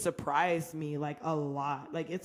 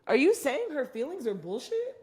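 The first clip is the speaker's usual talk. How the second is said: higher, louder disbelieving retort; incredulous challenge; 6 semitones higher, 4 dB louder than usual. Retold or imitated. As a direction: imitate the friend calling her out. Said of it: "she was like".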